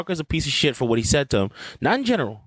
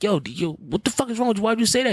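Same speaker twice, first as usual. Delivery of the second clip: higher-pitched mock challenge; acted confrontation; 7 semitones higher than usual. Host acting out the player confronting a smug writer, mock-indignant. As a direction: demand it like a fight about to start.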